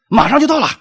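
high, put-on voice of a friend running late; late friend on phone. Busy brother assures his waiting small group by phone, mock reassurance. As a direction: imitate a breezy, high-pitched phone promise. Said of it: "给他打电话的时候说".

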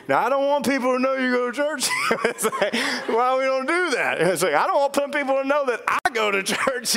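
lightly voiced churchgoer who hides going to church; mock churchgoer. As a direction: play the embarrassed member, half laughing. Voicing a church member's thought.

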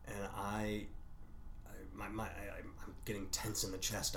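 whispered, tense and nervous. Real, not acted. Nervous about movie news.

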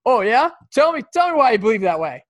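condescending. Shown as a demonstration. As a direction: talk down to them with a sneering challenge. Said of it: "a fucking condescending way".

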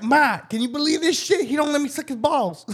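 high, loud hammed-up complaint to mom; mock Italian girlfriend shouting to her mom; mock outraged girlfriend. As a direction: whine it to your mother, outraged. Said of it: "They'd be like".

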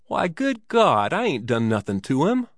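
high, loud, aggrieved protest; loud with grievance. A narrator's reading. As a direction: protest your innocence with a burden of grievance. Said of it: "loud with its burden of grievance".

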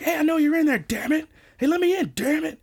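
tough-guy lines in a tiny squeak; squeaky sidekick voice. Retold or imitated. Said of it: "he's like".

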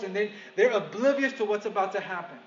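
normal talking voice

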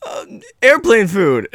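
hammy slow airplane-food joke; high, slow mock comic whine; stand-up comic. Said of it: "we just go".

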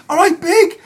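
put-on high, excited little kid; squeaky young fan greeting. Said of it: "and they were going".

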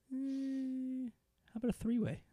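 high, teasing how about a three-way; a lightly voiced promoter suggestion; mock sly pitch. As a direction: sell the idea like a cheeky promoter. New Japan pitching a new match.

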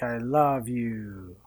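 low, very slow crooning lover; romantic accent. Acted. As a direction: stretch it out, dreamy and soft. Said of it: "you gotta talk in a real good accent".

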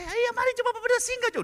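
very high, quick eager call; eager invitation. Retold or imitated. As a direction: beckon him in eagerly. (ibu mengundang pendeta dengan suara sangat tinggi dan cepat)

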